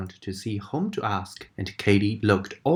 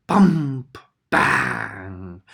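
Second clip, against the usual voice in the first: louder, punchy sound words; loud bump; 3 semitones lower, 6 dB louder than usual. A narrator's reading. Narrator marking Freddy's fall, loud and punchy. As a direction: hit each thud word.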